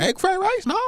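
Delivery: put-on very high, loud caricatured Chinese accent; exaggerated Chinese accent, shrieked questions; mock Chinese accent. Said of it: "try and talk like a Chinese".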